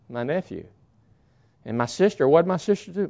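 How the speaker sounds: ordinary talk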